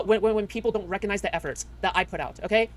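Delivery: normal talking voice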